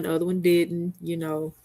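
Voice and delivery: usual speaking voice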